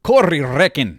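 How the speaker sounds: hammed-up Scottish brogue on Corey Reckon; Scottish accent. Asked by his friend for his best Scottish accent, a mock Scottish accent. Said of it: "Can you say that in your best Scottish accent for me?".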